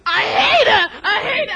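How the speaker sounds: very loud, hammed-up hateful yell; furious shout. Mickey Mantle fan raging at the film star, furious shout. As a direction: scream it out in a jealous rage. Jealous fan screams at rival.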